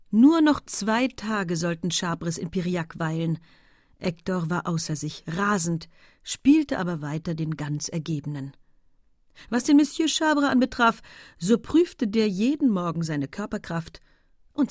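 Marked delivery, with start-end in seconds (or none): none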